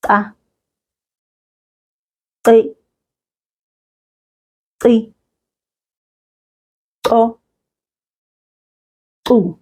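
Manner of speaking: "Ka, qi, qi, qo, qu" each with a sharp tongue click; click syllables. Shown as a demonstration. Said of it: "Repeat after me".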